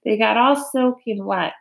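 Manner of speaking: ordinary delivery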